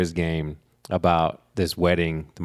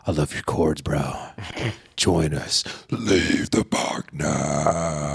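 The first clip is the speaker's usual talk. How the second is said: death metal growl; 4 semitones lower, much slower than usual. Retold or imitated. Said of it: "Whoa, whoa. ... I wasn't ready for that".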